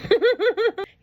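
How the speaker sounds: bright high burst of laughter. Real, not acted. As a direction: laugh at your own nerves. Laughs about getting emotional.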